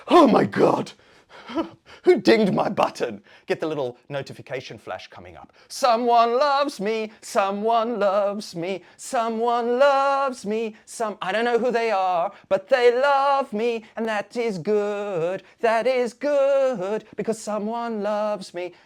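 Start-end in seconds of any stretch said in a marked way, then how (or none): 0.1-3.2 loud, high mock amazement; hammed-up delighted shock. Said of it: "I'm like". 5.7-18.7 loud, high, drawn-out exulting; ecstatic mock rapture. Acted rapture at getting a like.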